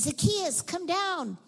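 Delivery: high, loud call up into a tree. Acted. Jesus calling the man down from the tree, calling out. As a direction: call him down from above.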